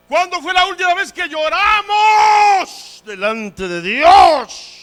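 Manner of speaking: high, very loud challenge; full-force pulpit shout, slow; impassioned preaching shout. Real, not acted. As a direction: cry out the question with drawn-out words.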